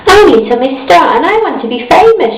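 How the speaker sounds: fast and high, tumbling out; all in a rush. A narrator's reading. Miss Star tells the large man, fast and high. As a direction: blurt it all in one breath. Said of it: "she said all in a rush".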